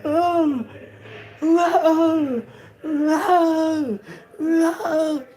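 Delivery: high, loud, strained moans of pain; imitated anguished cries.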